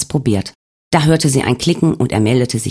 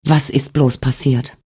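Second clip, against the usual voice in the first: sighing question; slower than usual. Acted. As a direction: let it fall out with a sigh. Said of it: "seufzte sie".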